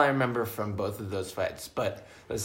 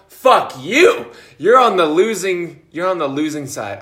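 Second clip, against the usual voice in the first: mock angry shout; 3 semitones higher, 8 dB louder than usual. Taunting the listener in jest, hammed-up shout. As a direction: shout it at the rival for fun.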